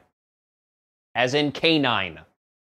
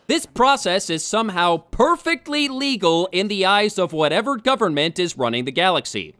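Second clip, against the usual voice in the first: usual speaking voice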